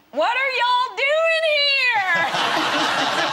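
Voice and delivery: very high, loud, slow drawn-out Southern squeal; a waitress recognizing a movie star, high loud mock squeal. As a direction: mimic a starstruck Southern waitress shrieking at a star. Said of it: "she went".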